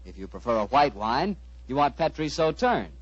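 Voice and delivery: usual speaking voice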